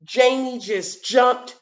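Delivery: very slow, deliberate alliteration; slow demo. Shown as a demonstration. Teacher modelling her own tongue twister slowly. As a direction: land on each j word slowly. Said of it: "all of these words have that j".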